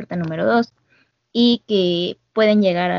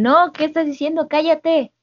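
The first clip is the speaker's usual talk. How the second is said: retold shout; 7 semitones higher than usual. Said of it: "le gritan".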